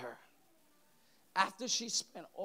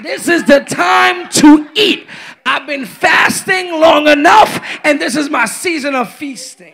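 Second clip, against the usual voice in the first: loud, high, shouted church preaching; full-force preaching; 9 semitones higher, 29 dB louder than usual. Real, not acted. Preacher rousing the church to feast, full-force preaching. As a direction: belt it out to rouse the church.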